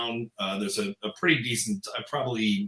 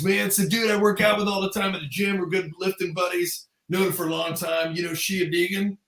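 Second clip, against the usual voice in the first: higher, chummy bragging voice; pitched-up buddy-buddy quote; name-dropping driver; 7 semitones higher than usual. Retold or imitated. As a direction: imitate a driver boasting about his gym buddy.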